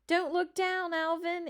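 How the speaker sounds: a sister shrieking a warning to her brother. Acted. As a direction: shout the warning up the tree. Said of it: "Annabelle shrieked".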